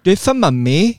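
high coaxing stage-mum line; sing-song like a pushy mother. Shown as a demonstration. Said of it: "Just give me a rough starter".

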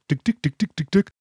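quick light clicking vocal imitation; oil can ticks. Host imitating the oil can squirting Max's leg brace, clicking ticks. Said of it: "oil can".

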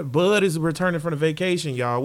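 usual speaking voice

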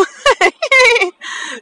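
high-pitched giggle; real amused laugh. Real, not acted. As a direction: burst of high giggling at yourself. Laughs at numb mouth.

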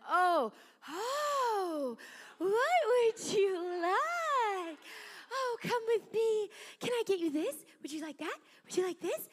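high, slow, gushing sugary voice; syrupy, drawn-out fake sweetness; fawning lady. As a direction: suck up to the evangelist's kid. Fawning lady once she learns.

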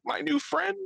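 high-pitched, hammed-up near-crying; mock tearful. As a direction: play it like you're about to cry over a pal. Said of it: "getting ready to cry".

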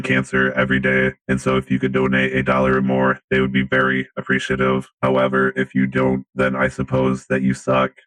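deep, monotone robot voice; robot faking human. Acted. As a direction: speak like a stiff robot pretending to be human. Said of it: "Nickotron three thousand robot".

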